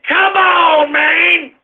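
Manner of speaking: high, loud "come on, man"; a host cheering the stock market; excited gloat. Acted. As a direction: throw it out triumphantly. Gloating over the record.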